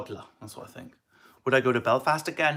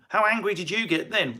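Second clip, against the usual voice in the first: normal talking voice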